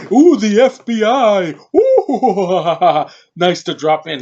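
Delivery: loud, high, slow hammy villain voice with a laugh; over-the-top villain. Retold or imitated. Reviewer imitating the movie villain Hugo Snyder, loud hammy put-on voice. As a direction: ham it up like a cartoon bad guy. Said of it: "he's so over the top".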